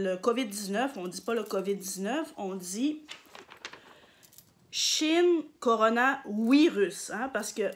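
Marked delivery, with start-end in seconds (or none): 4.7-7.1 Shin Corona Virus, slowly, with "Virus" starting on a W; "Virus" said as "wi-rus", drawn out; Japanese pronunciation. Shown as a demonstration. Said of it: "en japonais, on dit pas virus".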